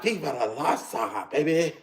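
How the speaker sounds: soft, playful charmer with a laugh; mock smooth reply. Streamer retelling his reply to admiring Latinas, soft mock flirting. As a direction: play it lightly as a hushed flirt. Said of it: "I'm like".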